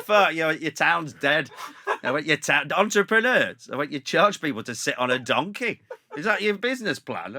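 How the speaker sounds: mock-furious onstage tirade; retold rant. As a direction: berate the audience with comic outrage. Retells his rant at the crowd.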